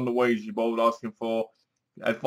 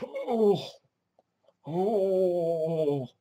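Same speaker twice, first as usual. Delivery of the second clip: higher strained groans, imitating the husband; mock old man groan; 4 semitones higher, 6 dB quieter than usual. Reviewer imitating the husband groaning, higher and strained. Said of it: "he goes like this".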